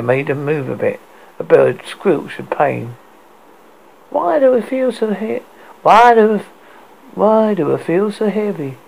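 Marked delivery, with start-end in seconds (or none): 4.1-8.8 high whiny complaint; complaining. A narrator's reading. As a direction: whine about the weight. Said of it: "she complained".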